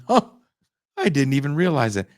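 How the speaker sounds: high, airy mock surprise; mock airhead voice. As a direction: play her as a dim, surprised woman. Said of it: "She's like".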